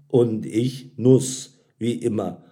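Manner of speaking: plain reading voice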